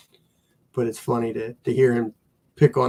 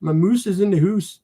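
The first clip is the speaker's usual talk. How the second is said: put-on raised, jokey Canadian accent, hoose for house; mock Canadian; 6 semitones higher than usual. Teasing Canadian viewers in mock Canadian accent. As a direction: ham up a Canadian moose and hoose. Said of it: "Canadian trait".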